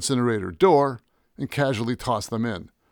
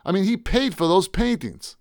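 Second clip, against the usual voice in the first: higher, agitated protest; agitated bewilderment; 8 semitones higher, 3 dB louder than usual. Acted. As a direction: argue it out, voice pushed up. Frantic, cannot make sense of it.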